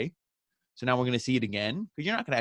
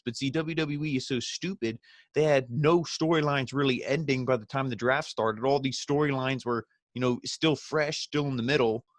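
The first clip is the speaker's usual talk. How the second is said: usual speaking voice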